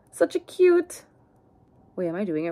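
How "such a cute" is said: adoring sigh. Real, not acted. Sighing over the doll.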